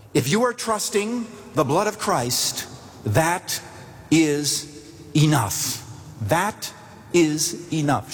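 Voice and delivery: THAT, IS, ENOUGH set apart by pauses; measured, insistent pauses around each word of "that is enough"; emphatic reassurance. Retold or imitated. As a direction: land "that is enough" word by word, deliberately. Pastor firmly reassures the crying woman.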